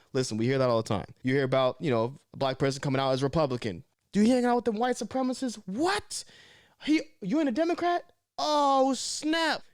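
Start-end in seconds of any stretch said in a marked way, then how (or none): 4.1-9.6 much higher, scandalised critic voice; mock disbelief. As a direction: play shocked gossip. Mimics critics about Republicans.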